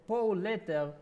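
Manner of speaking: ordinary talk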